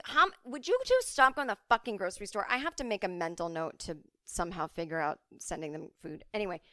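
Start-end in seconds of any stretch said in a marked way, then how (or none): 0.0-2.5 exasperated scolding. Retold or imitated. Scolding mom.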